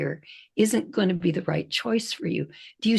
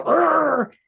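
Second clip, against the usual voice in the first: a short snarling growl, higher and louder; growling dog; 8 semitones higher, 4 dB louder than usual. Retold or imitated. The two dogs lunging at each other, growling. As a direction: snarl like a riled terrier. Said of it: "but they go".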